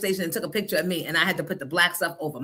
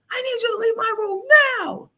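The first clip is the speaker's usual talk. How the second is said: son's voice; 5 dB louder than usual. Retold or imitated. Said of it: "He was like".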